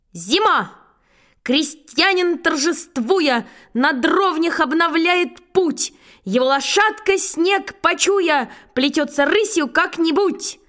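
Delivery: drawn-out, high-pitched schoolboy declamation; brisk-spirited recitation. Acted. Schoolboy reciting the wrong poem to his teacher, in bold declamation. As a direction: sing out the verse with stretched words and confidence. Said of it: "И я бодро завёл".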